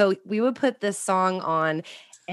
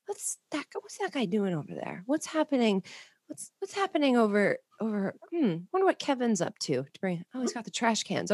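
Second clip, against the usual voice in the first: Mom's inner narration; 7 dB quieter than usual. Retold or imitated. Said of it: "constant narration".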